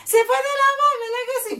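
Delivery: high, teary mock praise chant; hammed-up fervent churchgoer. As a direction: overdo the pious fervour. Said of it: "Hay gente que eso hace que dice".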